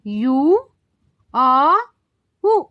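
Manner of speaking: यू, अ, उ as separate sounds with pauses; three U sounds. Shown as a demonstration. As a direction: give यू long, अ open, उ short. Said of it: "sound देते हैं". (यू, अ, उ अलग-अलग बोलें)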